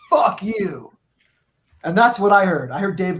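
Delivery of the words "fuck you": retold angry curse. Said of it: "Dave looks at him and goes".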